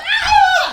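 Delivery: high, loud panicked shriek; crowd scream. Acted. People scattering from the bolting horse, panicked scream. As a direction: shriek like a fleeing bystander. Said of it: "they're screaming".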